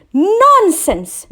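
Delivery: high, loud scornful dismissal; queen quarrelling with the king; quarrelsome. Acted. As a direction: cut him off sharply. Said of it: "she quarreled".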